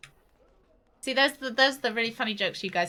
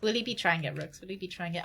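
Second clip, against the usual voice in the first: ordinary talk, nothing marked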